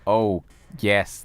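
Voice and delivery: ordinary talk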